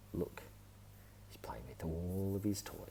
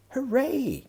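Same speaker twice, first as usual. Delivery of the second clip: high, loud hooray for Peppa; excited cry; 12 dB louder than usual. A narrator's reading. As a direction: cheer it out like a delighted little pig. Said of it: "cries Peppa".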